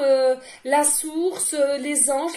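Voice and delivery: ordinary talk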